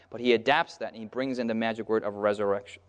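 normal talking voice

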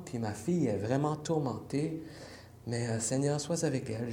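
ordinary talk

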